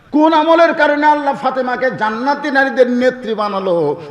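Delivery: high, ringing preacher's question; impassioned preaching. Real, not acted. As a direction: pitch it high, then let it fall at the end. Preacher poses rhetorical question. (উঁচু গলায় ওয়াজের প্রশ্ন)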